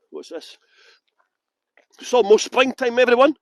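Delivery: ordinary delivery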